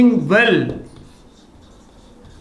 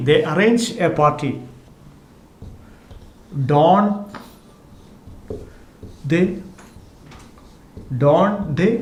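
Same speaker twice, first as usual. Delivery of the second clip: slow dictation; much slower than usual. Shown as a demonstration. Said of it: "teacher dictates the example slowly".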